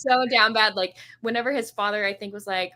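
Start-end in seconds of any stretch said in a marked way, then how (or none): none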